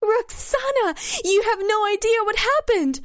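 high, loud, fast burst of joyful news; thrilled woman bursting with news on the phone; enthusiastic exclamation. Acted. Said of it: "the enthusiastic voice of a woman".

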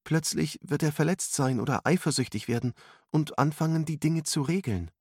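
put-on high-pitched girlish character voice; worried girl. A narrator's reading. Alice worries how Arthur will react, high girlish voice. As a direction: raise the pitch for an anxious girl.

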